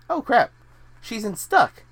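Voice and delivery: sudden high, loud realization; surprised. Real, not acted. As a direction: blurt it out, suddenly amazed. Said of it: "my mind was blown just now".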